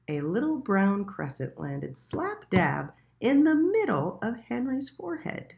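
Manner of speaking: usual speaking voice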